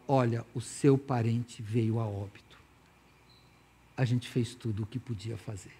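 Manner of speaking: low, soft, measured doctor voice; grave doctor. Acted. Doctor tells family their relative has died, grave. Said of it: "Quando o médico chega e fala".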